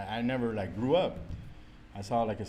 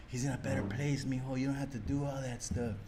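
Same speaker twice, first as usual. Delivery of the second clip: mock soothing relative; 5 dB quieter than usual. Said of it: "they were just like".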